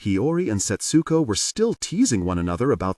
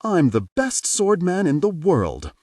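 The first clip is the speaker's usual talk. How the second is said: proud boast; 8 semitones higher, 3 dB louder than usual. A narrator's reading. Boy boasting with toy sword.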